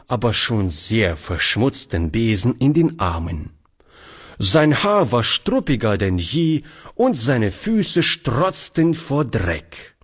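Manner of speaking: ordinary delivery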